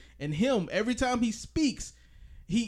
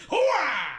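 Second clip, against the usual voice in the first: Pacino's hooah; 9 semitones higher, 5 dB louder than usual. Retold or imitated. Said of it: "I love this Al Pacino performance".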